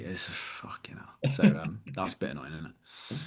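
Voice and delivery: low, breathy muttered aside under the breath; whispered aside. Real, not acted. Trainer grumbling to co-host about annoying clients, whispered. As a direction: drop to a half-whisper, almost to yourself.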